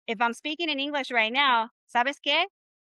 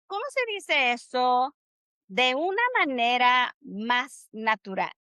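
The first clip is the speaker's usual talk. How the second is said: "¿Cómo se dice eso de una manera más natural?" with a sing-song rhythm and a pause after "eso"; natural Spanish rhythm. Shown as a demonstration. Teacher models the phrase for students, song-like rhythm. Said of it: "You hear my rhythm of how I say that".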